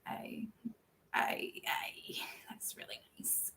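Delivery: whispered, embarrassed thanks; bashful whispered thanks; shy whisper. Real, not acted. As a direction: barely breathe it out, flustered. Flustered by compliments from chat.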